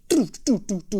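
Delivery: crisp mouth stick clicks; drum tick imitation. Shown as a demonstration. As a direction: tap it out like a drumstick. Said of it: "un tic-tic".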